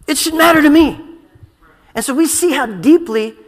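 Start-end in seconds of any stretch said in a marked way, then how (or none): 0.1-1.0 raised, loud declaration; emphatic conviction. Real, not acted. Preacher declaring Passover matters to him, emphatic and loud. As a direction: drive it home high and loud.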